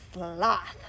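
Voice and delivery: ordinary delivery, nothing marked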